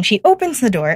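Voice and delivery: ordinary talk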